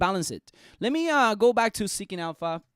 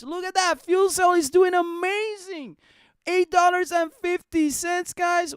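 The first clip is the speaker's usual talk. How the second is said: higher, excited exclamation; 9 semitones higher, 3 dB louder than usual. Real, not acted. Vlogger showing viewers the rising fuel cell price: excited. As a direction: show off the gains with delight.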